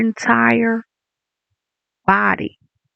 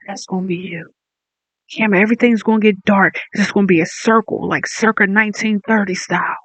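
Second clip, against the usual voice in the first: usual speaking voice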